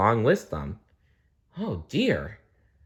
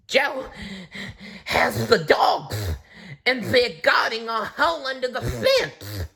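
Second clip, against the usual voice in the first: out of breath, high voice; panting; 13 semitones higher than usual. A narrator's reading. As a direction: gasp it out, out of breath. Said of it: "panted Flap".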